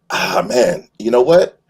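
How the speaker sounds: usual speaking voice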